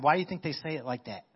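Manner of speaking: usual speaking voice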